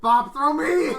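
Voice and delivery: high laughing yell; laughing shout. Acted. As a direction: scream it through laughter. Dwarf begs to be thrown.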